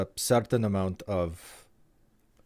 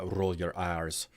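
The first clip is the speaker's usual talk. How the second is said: rolled Scottish R; 3 semitones lower, 6 dB quieter, slower than usual. Shown as a demonstration. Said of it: "really leaned in heavy on a Scottish accent".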